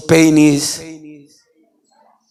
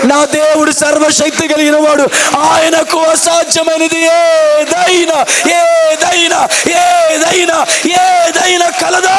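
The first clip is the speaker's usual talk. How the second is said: high, shouted revival preaching; fiery preacher crying out to the crowd; preaching at full force; 3 dB louder than usual. Acted. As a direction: hammer the question, then shout it. Shouted sermon climax.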